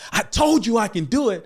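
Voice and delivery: hyped-up retort; defiant shout. Retold or imitated. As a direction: prove the director wrong, full volume. Running to front, proving himself.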